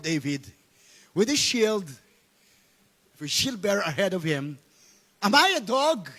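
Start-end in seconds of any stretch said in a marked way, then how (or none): 5.2-6.1 raised, higher challenge; a mildly roared taunt; Goliath's roar. Acted. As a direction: read the giant's question with mild indignation. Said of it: "he roared at David".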